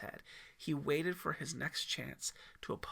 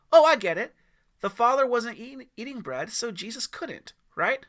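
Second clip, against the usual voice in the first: put-on high, loud boy voice figuring it out; excited kid; 8 semitones higher, 12 dB louder than usual. Timmy excitedly answers the storyteller, high and loud. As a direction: blurt it like a kid who just got it.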